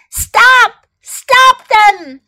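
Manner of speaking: high, loud scream for help. Acted. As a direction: scream after the thieves. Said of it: "Screamed the woman".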